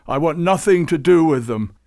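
put-on furious old saint roaring. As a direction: bellow it back at him. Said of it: "roared Babucar".

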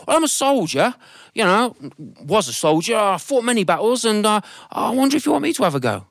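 put-on high, bluff old soldier voice; an old soldier offering his service; proud old soldier.

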